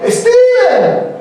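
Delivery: high, held name call; parent calling out. Acted. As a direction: call the child from afar, very high. Acted parent calling a child. (llamado agudo y alargado de padre)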